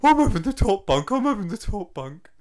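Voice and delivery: put-on high, loud childish whine over the top bunk; mock insistent cellmate. Mocking his podcast partner as a cellmate, high loud whine. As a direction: bleat it like a spoilt kid. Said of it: "you still being like".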